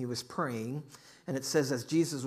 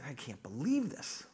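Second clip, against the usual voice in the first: mildly acted dismay; a touch of crestfallen disbelief; dejected. Woman stuck with her ex in heaven, dejected. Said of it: "she's just dejected".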